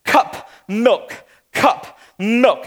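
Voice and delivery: put-on one word at a time, baby talk; little toddler demands with pauses; imitating toddler. Toddler pointing at the fridge, baby-talk demand. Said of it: "points to the fridge and goes".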